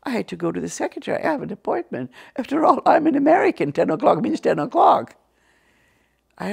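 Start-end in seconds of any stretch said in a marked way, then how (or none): 1.2-5.2 high, loud indignant protest; firm, raised-voice insistence; acted insistence. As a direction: insist loudly, pitched well up. Insisting on her appointment.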